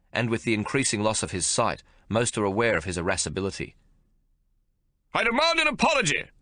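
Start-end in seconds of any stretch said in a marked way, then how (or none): 5.1-6.3 high, loud barking officer; barked demand. A narrator's reading. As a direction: bark it like an angry general. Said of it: "Major General Bridges barks".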